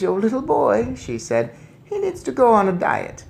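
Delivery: put-on high, brisk old lady; brisk grandmother. Grandmother handing boy to father, brisk. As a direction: hand him over briskly.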